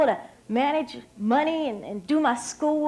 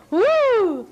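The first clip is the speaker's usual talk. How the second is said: high laugh then a loud woo; amused high laugh and whoop; 8 semitones higher, 6 dB louder than usual. Real, not acted. Laughing at crazy Romanian joke.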